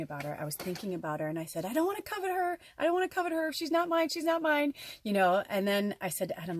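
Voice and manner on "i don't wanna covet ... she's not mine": much higher, sing-song self-talk; high singsong self-reminder; high pleading. Retold or imitated. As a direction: chant it to yourself like a plea. Talking herself out of wanting the doll.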